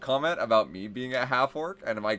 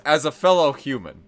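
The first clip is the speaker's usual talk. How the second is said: lightly played half orc, louder and pitched up; half orc posing as human; 4 semitones higher, 8 dB louder than usual. Half orc addressing his party as one of them, lightly acted earnestness. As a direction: declare it loudly, a hint of earnest half orc. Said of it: "I would always say sentences like that".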